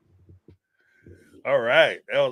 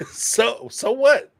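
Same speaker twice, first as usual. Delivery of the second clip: higher, rising mock so what; dismissive retort; 9 semitones higher, 5 dB louder, much slower than usual. Imagined comeback to Cody, high dismissive so what. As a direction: toss it back like who cares.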